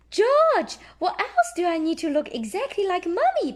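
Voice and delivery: high, loud eager question; lightly voiced little-girl question; Peppa asking. A narrator's reading. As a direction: call George high and eager.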